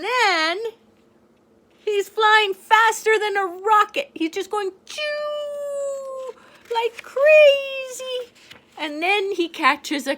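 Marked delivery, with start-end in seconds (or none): 1.8-8.3 high, louder, stretched-out rocket excitement. Pretend. Reading to a child, high thrilled rocket flight. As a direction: ride the words up like a rocket.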